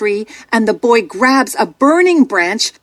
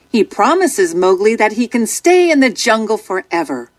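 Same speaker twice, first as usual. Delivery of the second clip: ordinary delivery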